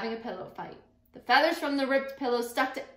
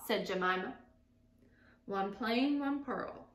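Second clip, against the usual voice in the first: ordinary delivery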